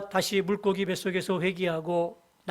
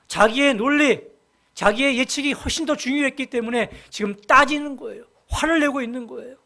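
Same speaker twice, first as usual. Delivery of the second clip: louder, rising sermon emphasis; fervent preaching; 3 semitones higher, 7 dB louder than usual. Acted. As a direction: drive the point home from the pulpit. Preacher builds to a peak.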